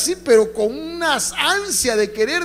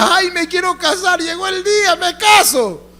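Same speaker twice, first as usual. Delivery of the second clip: lightly voiced giddy would-be bride, higher; mock eager bride; 8 semitones higher than usual. Pastor mocking a congregant eager to marry, giddy and higher. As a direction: play the dreamy wedding-eager girl, a touch high.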